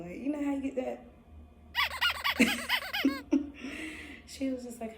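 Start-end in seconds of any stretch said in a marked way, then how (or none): 1.7-4.2 bright high laughter burst. Real, not acted. Remembering the grin, high laugh. Giggling about cheesing.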